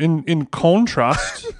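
slow Liverpool-flavoured put-on accent; Beatlesy accent. Attempting Aussie that drifts to Liverpool, slow Beatlesy accent. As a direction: drawl it like a Beatle. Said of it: "too Beatlesy. Pull it back".

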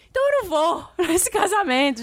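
mock outrage, up high; revoltada. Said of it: "já revoltada". (revoltada e indignada)

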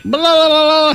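high, drawn-out blah-blah noise; mimicked chatter. Imitating what others keep saying, mock babble. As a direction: run it into one long high babble.

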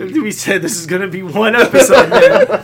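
loud, high, laughing protest; breaking into laughter. Real, not acted. Co-host protests about the one episode, breaking into laughter. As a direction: crack up on the last word. Co-host cracks up mid-sentence.

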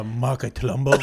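put-on lightly caricatured Chinese accent, rising at the end; mock Chinese accent. Co-host giving dreamed caller's name, mock Chinese accent.

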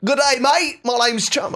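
loud, very high Australian fish; Australian accent. Acted. Auditioning as an Australian reef fish, broad Australian accent. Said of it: "native to the coral reef of Australia".

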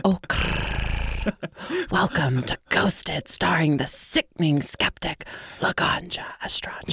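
eerie breathy ghost whisper; slow, haunting whisper from beyond. Pretend. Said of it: "I feel like I should do that in my ghost voice".

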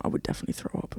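whispered, queasy and hungover. Real, not acted. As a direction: whisper it like you might throw up. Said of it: "I don't feel well".

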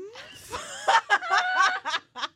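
loud, high burst of laughter. Real, not acted. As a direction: howl with laughter.

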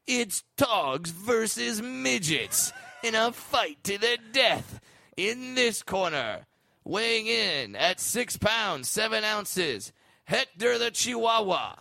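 slow, booming fight announcer voice. Retold or imitated. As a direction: hype it up like a ringside announcer. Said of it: "this is sounding like a Fox special from the late nineties".

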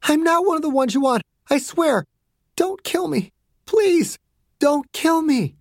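high, frightened pleading; begging for life. Acted. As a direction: beg your captors to spare you. Captive girl begs for her life.

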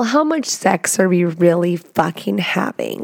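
usual speaking voice

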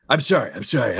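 normal talking voice

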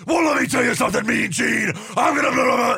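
fast, high shouting wrestler promo; Hulk Hogan impression. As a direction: roar it out like a pumped-up wrestler. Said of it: "Hogan would take over".

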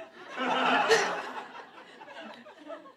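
unvoiced wheezing laugh; helpless giggle; breathy laughter. Real, not acted. Reader wheezing with laughter.

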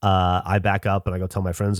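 ordinary delivery

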